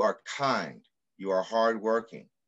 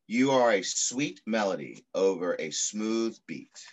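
put-on reading to children. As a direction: read it like an affirming poem to children. Reads picture book aloud.

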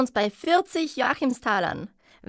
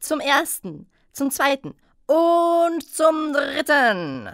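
yelling across the room; slower than usual. Acted. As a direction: bellow the final call to the room. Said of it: "Die Hexe brüllte durch den Raum".

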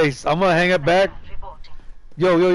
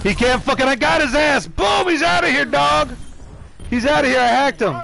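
excited celebration; 9 semitones higher than usual. Real, not acted. Celebrating a kill.

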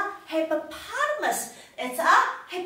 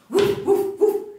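short, loud, higher woofs; medium dog barking; 7 semitones higher, 8 dB louder than usual. Shown as a demonstration. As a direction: bark like a middle-sized dog. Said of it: "the middle-sized dog".

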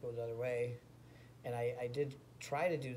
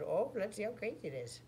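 put-on high babyish challenge; cocky baby daring them; funny baby voice; 9 semitones higher than usual. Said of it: "I talk, like, real funny".

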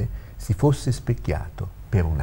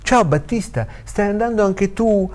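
high-pitched, eager hello; lively, high question to a colleague; excited greeting; 13 semitones higher, 3 dB louder than usual. Acted. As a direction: hail a friend in the street. Claudio greets an old colleague.

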